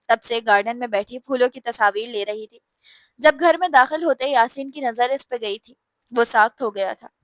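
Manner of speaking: plain reading voice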